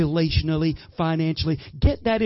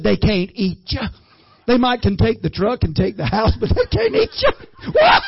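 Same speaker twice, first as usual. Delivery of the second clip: mother-in-law; 4 semitones higher, 5 dB louder than usual. Retold or imitated. Mother-in-law quoted, voice rises.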